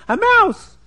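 high, loud mock scream of alarm; acted-out panicked shriek. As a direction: cry it out high and loud like a scared woman. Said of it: "And she's screaming".

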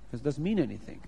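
plain reading voice

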